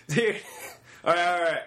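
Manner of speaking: ordinary delivery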